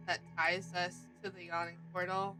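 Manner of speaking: ordinary talk, nothing marked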